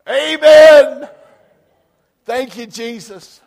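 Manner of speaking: very loud, high praise; shouted amen. Real, not acted. Pastor praising after the joke, loud and high. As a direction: cry out amen, then slow thanks.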